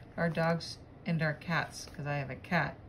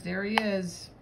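usual speaking voice

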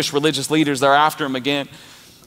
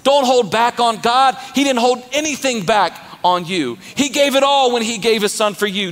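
full-force preaching; 8 semitones higher than usual. Acted. Pastor rallying the congregation, preaching at full force. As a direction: preach it hard to the crowd.

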